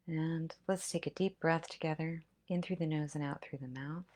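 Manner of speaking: calm breathing instructions; guided breathing. Acted. Guides deep breaths together.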